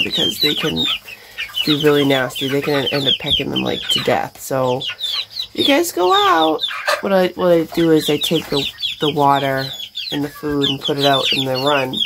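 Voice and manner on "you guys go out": coaxing pets. Real, not acted. Coaxing chickens out.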